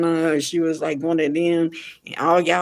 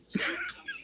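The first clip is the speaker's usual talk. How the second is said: scoffing laugh; 14 dB quieter than usual. Real, not acted. Scoffing at fans' excuse.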